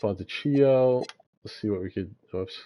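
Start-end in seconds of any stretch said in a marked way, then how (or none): 0.0-1.0 "Fontecchio" with a "ch" sound in place of the k; "Fontecchio" drawn out, with cch said as in "cheese"; mispronunciation. Real, not acted. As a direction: say "Fontecchio" slowly as fon-te-CHEE-oh. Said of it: "That's how you say it".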